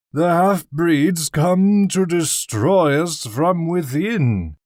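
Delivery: put-on high, loud, slow drunken slur; slurring drunk. A narrator's reading. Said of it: "a slurring voice".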